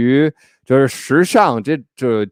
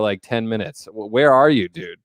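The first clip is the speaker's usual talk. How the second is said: ordinary talk, nothing marked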